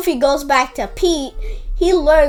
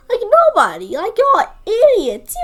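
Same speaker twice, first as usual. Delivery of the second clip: louder, higher, fed-up call-out; exasperated outburst; 6 semitones higher, 6 dB louder than usual. Real, not acted. Reviewer ranting at the characters, loud exasperation. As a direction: throw up your hands at the idiots.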